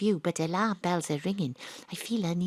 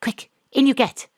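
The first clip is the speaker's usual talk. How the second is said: urgent hurry; 7 semitones higher, 8 dB louder than usual. Acted. Urging client into car.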